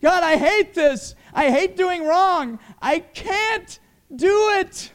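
high, loud, slow anguished plea; crying prayer. Acted. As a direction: beg God through tears. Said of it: "And he cried and he said".

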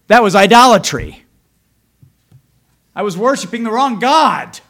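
loud, ringing pulpit delivery; full-force preaching. Acted. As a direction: preach it out to the whole church. Preaching confession to congregation.

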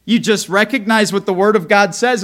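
ordinary delivery

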